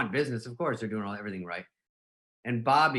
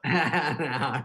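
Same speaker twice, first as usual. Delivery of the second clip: laughing protest; 3 semitones higher, 3 dB louder than usual. Retold or imitated. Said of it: "you go".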